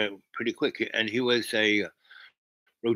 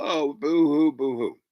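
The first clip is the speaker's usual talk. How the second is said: mock crying; 5 semitones higher, 4 dB louder than usual. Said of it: "but this is the voice".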